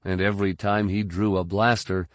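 plain reading voice

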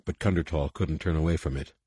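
usual speaking voice

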